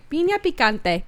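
light, put-on Spanish accent; playful Spanish accent. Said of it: "say it in my Spanish accent".

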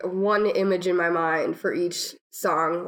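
ordinary delivery

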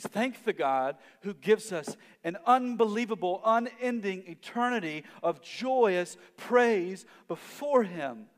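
full-force preacher crescendo; impassioned preaching peak. Acted. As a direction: swell to a pulpit climax. Preaching climax.

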